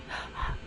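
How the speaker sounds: sharp intake of breath, very quiet; quiet startled gasp; soft gasp. Real, not acted. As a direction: gasp in surprise. Finds ship still there.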